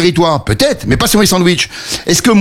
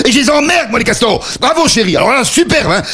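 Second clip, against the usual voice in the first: put-on high, exasperated rant at the kid; exasperated outburst; 8 semitones higher than usual. Father fed up with his daughter, exasperated high outburst. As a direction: blow up, pitch climbing in irritation.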